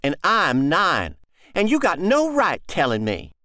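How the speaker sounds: put-on a boy pulling rank; boy's voice. John continues to sister.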